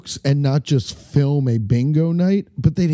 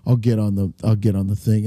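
normal talking voice

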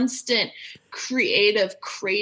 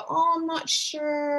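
slow, high hesitant witch voice; crone-like quaver of doubt; 5 semitones higher, slower than usual. Retold or imitated. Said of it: "You sound like a witch".

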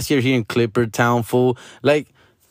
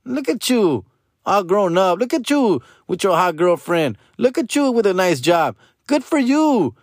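exaggerated congratulating voice, pitched way up; mock proud cousin; 8 semitones higher than usual. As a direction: gush over a distant cousin like a proud aunt. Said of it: "you're like".

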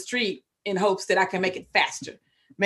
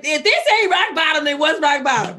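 laughing; 8 semitones higher, 6 dB louder than usual. Retold or imitated. Retelling rehab arrival, laughing.

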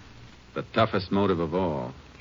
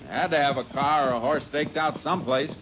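calling out on horseback; 8 semitones higher than usual. Acted. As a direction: call across to your partner while riding. Calling out while riding horseback.

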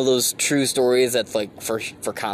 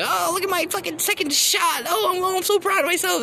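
mocking. Mocking vaccinated coworkers.

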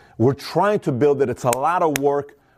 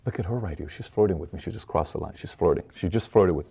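much lower and much softer, nearly whispered; hushed aside; 7 semitones lower, 6 dB quieter than usual. Pretend. Noticing a woman flirting, murmured secretively to himself. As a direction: confide it under your breath.

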